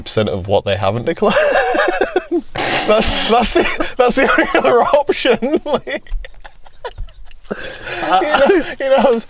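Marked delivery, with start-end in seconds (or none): 1.4-2.8 high laugh. Real, not acted. Laughing at own joke. 2.9-9.2 much higher, louder, words squeezed through laughter; laughing while talking. Real, not acted. Laughing at the thought of paying for undeclared earnings.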